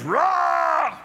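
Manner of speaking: high, loud, drawn-out mock roar; play roar. Granddaughter pouncing on her brother as the crocodile, mock roar. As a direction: roar like a child playing crocodile.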